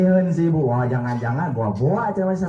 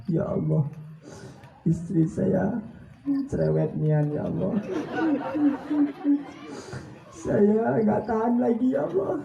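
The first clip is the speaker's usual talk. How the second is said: husband's quiet prayer. Retold or imitated. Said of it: "Pelan-pelan doanya tuh, Bu, seorang suami".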